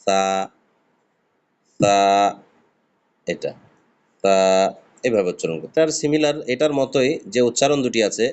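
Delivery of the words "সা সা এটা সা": সা with a light puff of air off the tongue tip; gentle lisped সা; Arabic tha demo. Shown as a demonstration. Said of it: "জিভের আগালে হালকা হাওয়া বের হবে".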